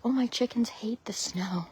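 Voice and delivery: hushed mock of what people say; a whispered imitation of fussy owners; whispered quote. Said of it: "People say".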